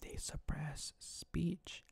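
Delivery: slow, hushed whisper; slow conspiratorial whisper; dramatic whisper. Acted. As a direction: lean in and whisper it like a secret. Repeated whispered punchline.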